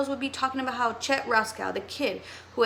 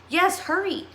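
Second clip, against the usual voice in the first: urgent; 8 semitones higher, 3 dB louder than usual. Acted. Urgent rescue reply.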